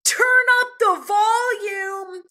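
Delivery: high, drawn-out mock holler; all-caps yell. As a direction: read it like it's typed in all caps. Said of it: "All caps".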